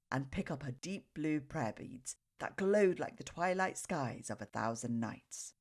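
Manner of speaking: plain reading voice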